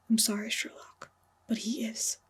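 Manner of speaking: soft, unvoiced whisper; gentle whisper. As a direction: breathe the bad news gently to him. Lestrade breaks the news gently.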